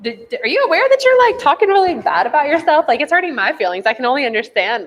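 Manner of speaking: high, fast, worried questioning; caring concern. Retold or imitated. Love voicing concern to someone hurting, high and quick. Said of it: "Love is like".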